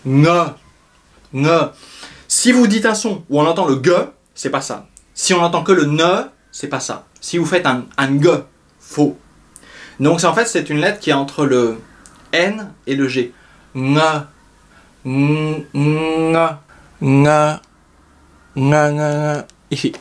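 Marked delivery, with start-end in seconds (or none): 0.0-1.6 "Ne" with a back ng onset; "Ne" nasal, ni N ni G; ng sound demo. Shown as a demonstration. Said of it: "là, ça, c'est difficile". 13.7-19.4 "nge" with a velar ng, between N and G; "nge" répété, entre N et G; ng drill. Shown as a demonstration. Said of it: "C'est un demi-N, un demi-G".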